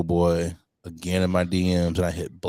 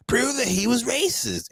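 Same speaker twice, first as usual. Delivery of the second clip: much higher mock voice; mocking DM troll. Quoting trolls in her DMs, high mocking voice. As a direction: parody the troll's demand.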